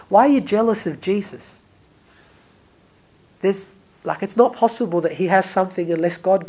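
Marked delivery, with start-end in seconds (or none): none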